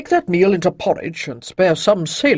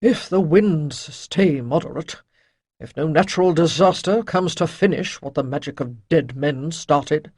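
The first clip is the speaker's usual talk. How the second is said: sighing; 5 dB louder than usual. A narrator's reading. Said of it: "Chayden sighed".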